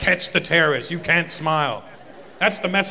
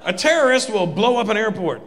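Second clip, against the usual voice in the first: heated rant; 7 semitones higher than usual. Acted. Escalating rant.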